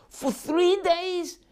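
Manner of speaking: high, drawn-out shocked exclamation; incredulous outcry. Retold or imitated. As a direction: let the disbelief burst out. Rabbi repeats, shocked.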